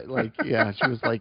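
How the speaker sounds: ordinary delivery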